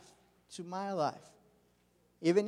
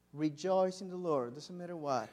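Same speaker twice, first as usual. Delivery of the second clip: ordinary delivery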